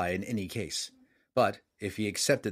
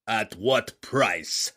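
growled; 3 dB louder, much slower than usual. A narrator's reading. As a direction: growl it like a wary king. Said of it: "growled Hefstag".

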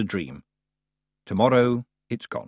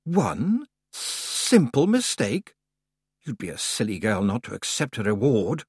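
haughty goddess voice. Acted. Summer Lady tempting Tiffany.

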